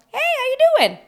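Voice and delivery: very high, loud put-on voice; mock casual greeting. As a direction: imitate an over-bright, empty hello. Mimicking his casual greeting to Daisy.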